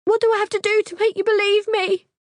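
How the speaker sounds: very high, loud frantic plea; desperate plea. Acted. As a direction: beg him desperately. Desperate pleading with Pete.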